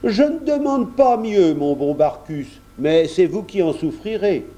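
high-pitched, gracious consent; obliging master. A narrator's reading. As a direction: grant a servant's request readily. Monsieur de Grignan consents.

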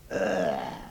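acted hacking to spit up; piglet's effortful gagging cough; straining retching cough. A narrator's reading. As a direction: hack as if bringing something up. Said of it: "grands efforts pour essayer de cracher".

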